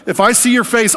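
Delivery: "your face" cried out high and loud in awe; frightened loud plea. Retold or imitated. Laid out on the floor, he cries out in fear to God. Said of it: "And I was like".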